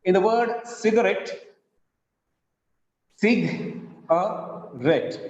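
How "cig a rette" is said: very slow "cig-a-rette" with gaps between syllables. Shown as a demonstration. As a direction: break "cig-a-rette" into three separate beats. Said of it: "it is trisyllabic".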